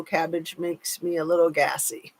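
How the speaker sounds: usual speaking voice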